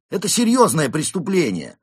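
high, loud outburst; barked warning. A narrator's reading. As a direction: bellow it as a stern accusation. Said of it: "рявкнул он". (рявкающий окрик)

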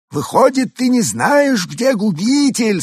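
high, slow alien echo. A narrator's reading. Alien echoing Bur. (высокий медленный голос пришельца)

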